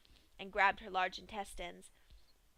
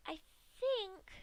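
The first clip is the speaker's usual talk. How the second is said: musing Pinkie; 11 semitones higher than usual. Acted. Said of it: "the pink pony mused".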